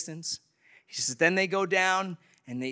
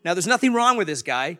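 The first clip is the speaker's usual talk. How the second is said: ordinary talk, nothing marked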